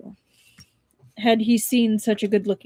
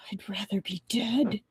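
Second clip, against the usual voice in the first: soft, breathless whisper; whispered despair; 9 dB quieter than usual. Acted. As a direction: whisper it as if giving up. Five syllables.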